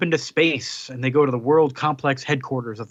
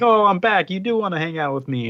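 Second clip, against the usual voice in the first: high, louder happy greeting, lightly acted; beaming and pleased to be back; excited; 6 semitones higher, 3 dB louder than usual. Imitating a villain greeting the girl, mildly excited. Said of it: "And he's like".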